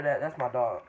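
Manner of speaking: normal talking voice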